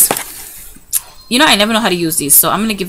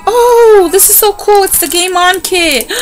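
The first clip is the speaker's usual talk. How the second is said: much higher and louder, bursting with delight; thrilled squeal; 10 semitones higher, 6 dB louder than usual. Real, not acted. Vlogger unboxing a kit for her sons, squealing with delight. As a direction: gush over the surprise kit.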